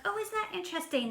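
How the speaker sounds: high, quick "oh, isn't that interesting"; light, fast mock-surprised reply. Replying to her friend Jessica, quick and surprised.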